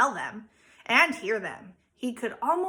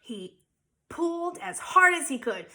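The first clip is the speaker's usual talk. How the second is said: plain reading voice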